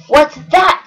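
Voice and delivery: loud high snort of surprise; loud startled snort. Acted. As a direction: scoff at it. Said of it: "snorted Yertle".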